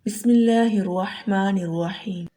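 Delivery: slow measured recitation; Quranic recitation. Acted. Teacher opening the lesson with slow recitation. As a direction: recite slowly and reverently.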